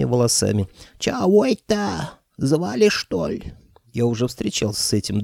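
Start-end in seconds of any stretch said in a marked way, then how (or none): 1.0-3.5 high, slow grumpy spirit voice; a cranky old ghost, much higher. A narrator's reading. Ghost sorcerer grumbling at being called, high and slow.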